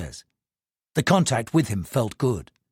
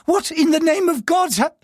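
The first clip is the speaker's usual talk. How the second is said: alarmed outburst. Acted. Panicked outburst.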